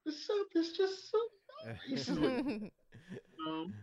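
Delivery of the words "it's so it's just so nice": very high, falsetto gush; mock swooning over niceness; gushing outsider. Mocking outsiders who think it's sweet. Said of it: "people mistake it as if like".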